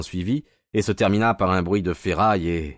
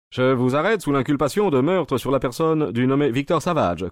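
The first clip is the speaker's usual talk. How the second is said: higher, louder official arrest voice; police inspector; 9 semitones higher, 6 dB louder than usual. A narrator's reading. Inspector arresting the villain for murder, official. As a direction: announce the charge formally, pitched up.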